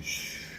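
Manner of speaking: soft hush of air; hushed sound of the pain settling; chest going shh. Retold or imitated. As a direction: let it fade out like pressure released. His chest settles after burp.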